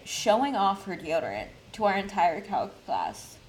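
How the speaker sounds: ordinary talk, nothing marked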